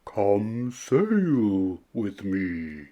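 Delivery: lightly voiced humpback whale; slow whale voice. A narrator's reading. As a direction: draw it out like a big kind whale. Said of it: "said to the snail".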